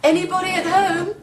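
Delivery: calling out. Acted. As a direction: call out to see if anyone's there. Calling into empty cottage.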